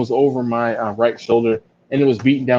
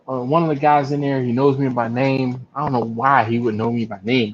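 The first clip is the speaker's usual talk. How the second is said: ordinary delivery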